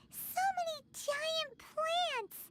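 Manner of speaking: high, drawn-out cries of wonder; awed excitement. Acted. Game character marvels at giant plants.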